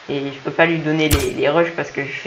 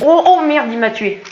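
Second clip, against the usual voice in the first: high, loud cry of shock; shocked outcry; 10 semitones higher, 6 dB louder than usual. Real, not acted. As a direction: shout as you get killed. Killed in game.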